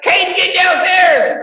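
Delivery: high, loud yell up the stairs, drawn out; wife yelling. Retold or imitated. Mom yelling to her son to come downstairs. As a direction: call the kid down like a mom at full volume. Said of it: "my wife was like".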